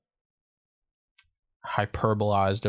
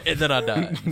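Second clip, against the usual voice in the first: laughing through the words; 5 semitones higher than usual. Real, not acted. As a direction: chuckle while echoing the joke. Laughing repeat of a joke.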